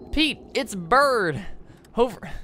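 high, slow southern twang for a bird. Acted. Talking bird calls to Pete, high slow southern accent. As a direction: drawl it out like a little southern bird. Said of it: "the bird has a southern accent".

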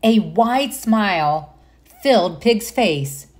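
gentle storybook narration; reading to children. Acted. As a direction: read it like bedtime to little ones. Reads picture book aloud.

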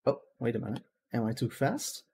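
ordinary talk

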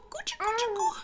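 put-on high, cooing baby talk. Niño cooing to his baby sisters, high. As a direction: coo like playing with babies.

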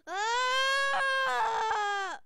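long, loud, high scream; frustrated scream. Real, not acted. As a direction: let out a full scream of frustration.

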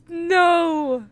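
sudden high, loud cry of fright; frightened cry. Real, not acted. Gamer cries out at the scary door in fear. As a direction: yelp out in real panic. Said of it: "I'm about to cry".

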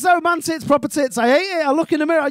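usual speaking voice

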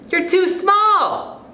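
put-on very high, drawn-out child's taunt; heckling pupil. A narrator's reading. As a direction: call it out slowly in a squeal-high kid voice. Pupil heckles Stuart in the story.

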